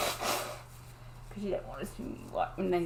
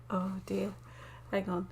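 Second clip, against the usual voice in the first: normal talking voice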